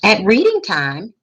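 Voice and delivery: plain reading voice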